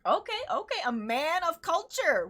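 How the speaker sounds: high, animated mock-grand welcome; playful excited welcome. Playful welcome to a fellow Chicago-style eater.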